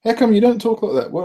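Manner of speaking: ordinary delivery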